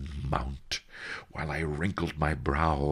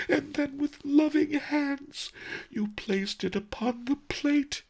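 crying. Acted. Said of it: "he wept".

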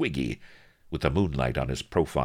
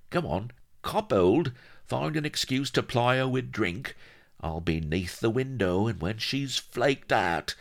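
higher-pitched, conspiratorial rogue; scheming little shyster; 6 semitones higher than usual. A narrator's reading. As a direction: urge him into a crooked plot.

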